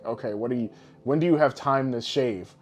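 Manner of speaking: ordinary talk, nothing marked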